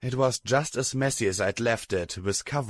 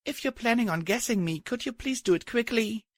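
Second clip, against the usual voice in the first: higher, drowsy mumble; sleepy mumble; 13 semitones higher than usual. A narrator's reading. Waking up to a pony touching her, mumbling. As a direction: mumble it half asleep. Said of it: "I mumbled".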